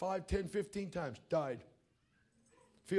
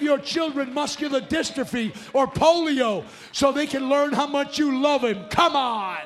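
louder, higher, shouted sermon; preaching at full force; 7 semitones higher, 10 dB louder than usual. Acted. Preacher shouting at parents in the congregation, fiery and loud. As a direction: rail against the lie with full fire.

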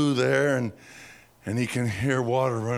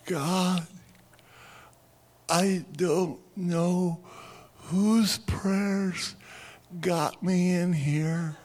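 high, slow, half-laughing plea; desperate prayer; 7 semitones higher, much slower than usual. Retold or imitated. Eddie trapped in chimney praying, high and slow. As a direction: pray like a stuck man at wit's end. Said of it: "and he says".

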